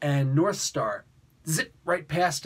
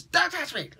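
much higher, a sharp frightened warning; shrill warning. Retold or imitated. Marina warns Puck off in a sudden high cry. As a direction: snap it out high as she turns feral.

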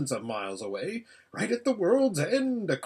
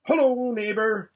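raised, louder friendly hail; calling out; 8 semitones higher, 6 dB louder than usual. Acted. Hedgehog greeting a rabbit by the path, calling out. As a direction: call over to a neighbour.

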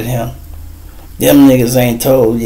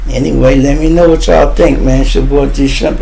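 usual speaking voice

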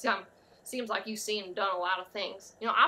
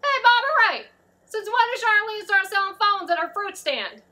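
loud, high holler to someone far off; high-pitched shouted question; yelling across; 10 semitones higher, 10 dB louder than usual. Acted. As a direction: call out a question at the top of your voice. Calling out to Bobby Ray.

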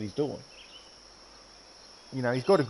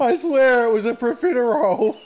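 high, loud laughing protest; 8 semitones higher, 5 dB louder than usual. Real, not acted. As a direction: swear it through laughter.

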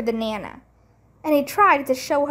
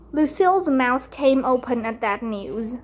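plain reading voice

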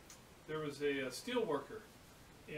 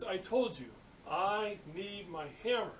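higher, emphatic mock exasperation; retold fury; 4 semitones higher than usual. Steel worker confronting apprentice downstairs, exasperated. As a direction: hammer it home, stretching the last words. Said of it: "the guy goes crazy".

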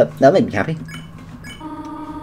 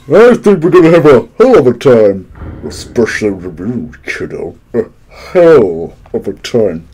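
lightly voiced villain; 4 semitones lower than usual. Voicing game character.

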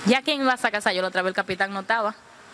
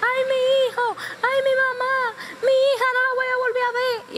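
imitated screaming. Guapón screaming for his family as the boat floods, imitated wail. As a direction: cry out in acted terror of dying. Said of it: "empieza a gritar".

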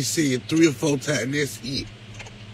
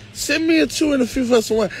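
pleading ask; 7 semitones higher, faster than usual. Real, not acted. Begging viewers for wine money.

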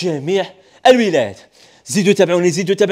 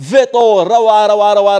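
excited; 6 dB louder than usual. Real, not acted. Salesman raving over a new sofa.

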